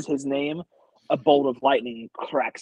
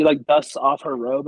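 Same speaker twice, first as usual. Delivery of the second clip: ordinary talk, nothing marked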